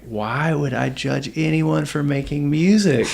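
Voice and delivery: slow, incredulous self-questioning; retold amazed realization. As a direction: voice a sudden realization, pitch lifting. Retells own realization during trip.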